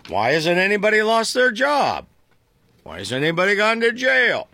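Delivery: hammed-up politician demanding why nobody lost their job; JD Vance impression. Host mimicking Vance at Trump's press event, mock-indignant. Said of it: "Why am I talking like this?".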